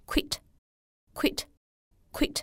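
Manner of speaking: quit with a short, forceful single vowel; slow quit. Shown as a demonstration. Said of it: "非常短促有力的单元音". (读quit，短促有力的单元音)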